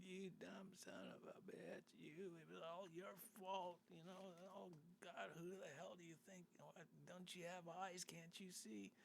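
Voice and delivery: high-pitched mock rant at the other driver; angry driver yelling. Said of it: "this guy's just going".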